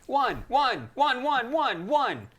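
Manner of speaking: high, loud mock combat shouts; mimicked LARP calls. Mocking larpers with boffer weapons, high rapid shouting. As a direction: imitate larpers calling out every hit. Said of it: "people with boffer weapons going like".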